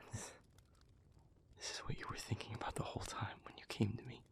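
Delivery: hushed, halting, then a quick rush of words; stunned realisation. Acted. Speaker grasps what partner planned all along, hushed and stunned. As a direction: breathe it out softly as it dawns.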